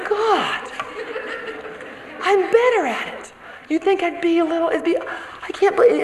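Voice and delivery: high, pleading despair at her own failing; half-laughing exasperation; exasperated groan at herself. Retold or imitated. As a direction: throw up your hands at yourself.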